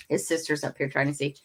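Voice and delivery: ordinary delivery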